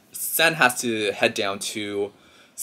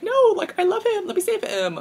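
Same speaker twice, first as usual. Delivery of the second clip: put-on high melodramatic wail of a girl in love; mock desperate plea. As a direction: squeal it like a lovestruck girl begging. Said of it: "Sen's like".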